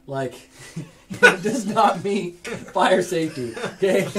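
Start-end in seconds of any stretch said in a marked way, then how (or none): 0.8-4.2 slow, laughing through the words; giggling while you scold. Real, not acted. GM laughing at window fail.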